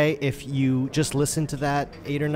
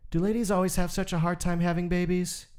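put-on higher, childlike voice for the boy; young boy asking; 7 semitones higher than usual. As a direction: voice a small curious son. Young Nick asks his father.

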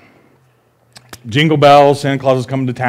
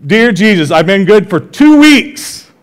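put-on higher, slower, pleading little girl; young daughter's voice; 7 semitones higher, 5 dB louder, slower than usual. Dad voicing his daughter reading her letter to Jesus, high and childlike. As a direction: play the kid bargaining with Jesus.

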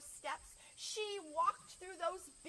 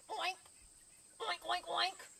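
one oink, then a quick run of three; pig oink; 4 semitones higher, 4 dB louder than usual. Acted. Pig answers the librarian, oinking. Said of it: "And Pig said".

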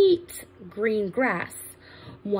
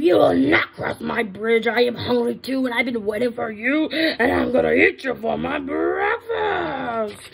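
screaming troll; 5 dB louder than usual. Acted. Troll threatens the medium goat, loud scream. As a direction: bellow it, dragging out the final threat. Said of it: "shook all over as he screamed".

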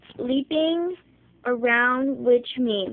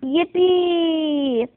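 a light, high cheer of joy; excited yell; 5 semitones higher than usual. A narrator's reading. Little bunnies yelling, a mild cheer. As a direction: bunnies cheering at good news. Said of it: "the other bunnies yelled".

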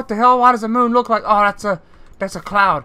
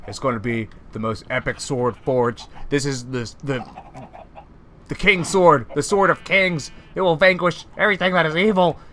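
dark lord; 8 semitones lower than usual. Acted. Villain monologue over crafted sword.

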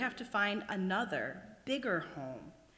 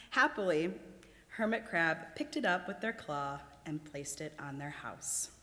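ordinary delivery, nothing marked

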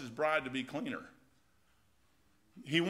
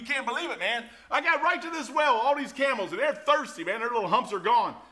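excited Eliezer; 6 semitones higher, 5 dB louder than usual. Acted.

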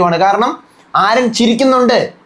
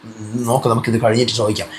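low, much softer hushed voice; hushed aside; 11 semitones lower, 6 dB quieter than usual. Retold or imitated. Appani quietly telling Akbar to ask later, hushed. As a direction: keep it down so the mic won't catch it. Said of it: "കാരണം big boss കേൾക്കാതിരിക്കാൻ".

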